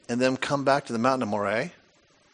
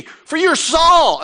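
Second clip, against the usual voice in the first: much higher, louder, slow "For you're Saul!"; exclaimed; 12 semitones higher, 9 dB louder, much slower than usual. Acted. The medium cries out to Saul, shocked, much higher and louder. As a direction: hit the reveal like a shocked cry. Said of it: "Exclamation point".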